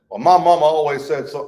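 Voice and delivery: loud imitation of a stubborn believer; mock insistence. Churchgoer citing mama as proof, loud mock insistence. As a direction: push it out as a cocksure claim. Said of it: "guy used to say that".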